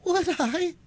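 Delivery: put-on high, outraged young man's retort; indignant astonishment. A narrator's reading. Duncan answering Cora in indignant disbelief. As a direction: fire it back in disbelief. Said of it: "echoed the indignant and astonished youth".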